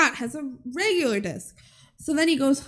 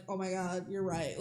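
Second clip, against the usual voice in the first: meek bank customers; 4 semitones lower, 16 dB quieter than usual. Retold or imitated.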